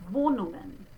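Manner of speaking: "Wohnungen" with no hard g after the ng; soft German ng. Shown as a demonstration. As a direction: say "Wohnungen" as vo-nung-en, g silent. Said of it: "I don't put the hard G sound".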